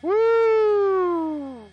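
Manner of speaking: long high-pitched "woo!"; whoop. Real, not acted. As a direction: let out a loud, sustained whoop. Whoops during the flight.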